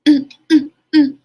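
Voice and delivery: imitating an annoyed dog owner with sing-song disapproval; passive-aggressive Karen. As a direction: play the fussy dog owner needling the parents. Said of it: "Like, giving all that energy".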